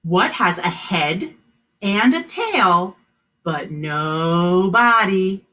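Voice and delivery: slow and emphatic question; drawn out, each part stressed; slow riddle reading. Shown as a demonstration. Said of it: "the new riddle".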